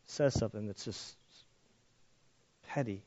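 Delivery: ordinary talk, nothing marked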